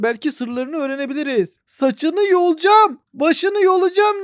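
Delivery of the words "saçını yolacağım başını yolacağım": slow, higher catfight threat; hammed-up angry girl; mock threat. Gamer voicing a ballerina threatening to pull hair, hammed-up.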